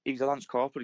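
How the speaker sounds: ordinary delivery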